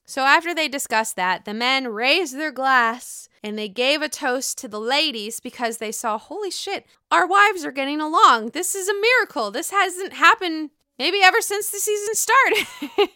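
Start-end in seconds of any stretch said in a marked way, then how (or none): 6.2-13.1 higher, amazed mock voice of the husbands; excited husbands. Husbands toasting wives, mock amazed. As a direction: play the men marveling at their wives.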